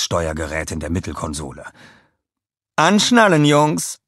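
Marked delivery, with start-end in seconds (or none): 2.8-4.0 high, loud smiling order; a high, bright-voiced command with a smile; Scarlett smiling. A narrator's reading. Scarlett tells the minions to buckle up, smiling. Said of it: "sagte sie lächelnd".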